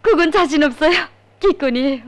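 loud playful refusal; laughing it off. Acted. Laughing off a request.

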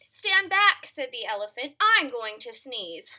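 high, loud, booming warning; loud elephant warning. Shown as a demonstration. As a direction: boom it out like a big elephant. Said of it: "repeated softer before".

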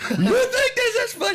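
high, laughing mock scold from his wife; mock angry wife. Husband mimicking his wife's scolding on the phone, high and laughing. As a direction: squeak out the wife's disbelief through laughter.

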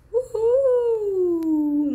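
high, drawn-out thrilled cry; excited whoop. Real, not acted. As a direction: whoop with delight as you drop. Whooping down the last slide. (grito longo e agudo de alegria)

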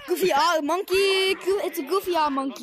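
loud goofy call. Real, not acted. Mocking a friend's avatar, a loud goofy call. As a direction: bellow it out, amused.

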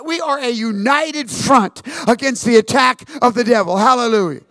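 loud, high-pitched preaching; full-force pulpit preaching; fervent preaching. Acted. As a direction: drive it home to the congregation.

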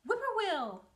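high, loud whippoorwill call; a bird calling across the road. A narrator's reading. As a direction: sing it out like a bird. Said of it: "a bird sang".

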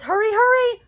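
high, loud hurried call; urgent call. Acted. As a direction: call out to hurry everyone. Reading book, voicing urgent call.

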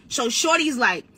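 ordinary talk

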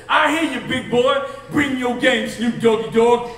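loud, rowdy encouragement; rowdy drunk cheer. Retold or imitated. A drunk fan at the stage hollering him on, loud.

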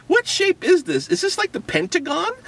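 ordinary talk

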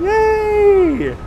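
high, loud "yay"; long, high-pitched little celebration; excited cheer. Real, not acted. Celebrates being back outside unmasked.

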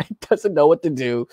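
chuckling through the words; amused laugh breaking into the line. Real, not acted. Telling of the governor freaking out, laughing.

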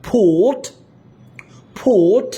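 ফোর্ট with a long "or", the r dropped; drawn-out vowel. Shown as a demonstration. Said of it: "এটার উচ্চারণটা টান দিয়ে বলতে হবে".

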